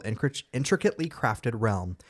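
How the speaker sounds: normal talking voice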